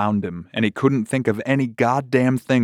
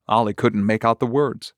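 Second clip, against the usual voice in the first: ordinary delivery, nothing marked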